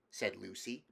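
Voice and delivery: plain reading voice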